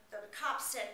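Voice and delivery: usual speaking voice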